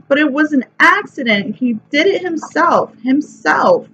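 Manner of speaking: high, whining mock sob; crying harder. A narrator's reading. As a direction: defend yourself through tears. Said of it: "Sheila cried louder".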